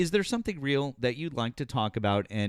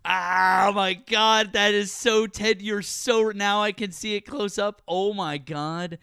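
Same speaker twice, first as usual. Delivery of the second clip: shocked recognition; 9 semitones higher, 5 dB louder, much slower than usual. Real, not acted. Host recognizes [name] in picture.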